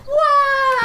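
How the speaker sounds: sudden high, loud cartoon wail; Mario's fall yell. Gamer mimics Mario falling off the cliff, high cartoon wail. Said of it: "I was like".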